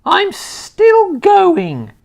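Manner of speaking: very high, very loud screechy crone whoop; wild old woman's shout. A narrator's reading. Grandma rising through the floor, screeching with glee. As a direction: whoop with mad joy. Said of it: "came the screechy voice".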